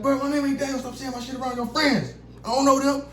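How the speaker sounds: high, annoyed correction; telling his uncle his name is not Daniel, high and annoyed. Retold or imitated. Scolding the uncle.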